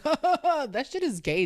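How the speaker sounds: high, slow teasing jab; mock blunt retort. Comic teasing the long-term couple, high and slow.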